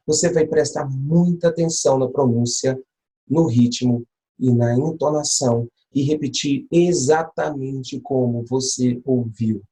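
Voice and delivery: ordinary talk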